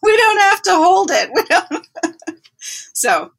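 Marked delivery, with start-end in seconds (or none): 0.0-2.4 words laughed out, higher and louder; laughing. Real, not acted. Telling friends the midwife joke while laughing. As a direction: giggle through the punchline.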